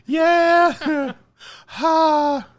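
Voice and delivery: high, loud, breathless relief; whew. Real, not acted. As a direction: blow out the heat and laugh. Reacting to burning hot sauce.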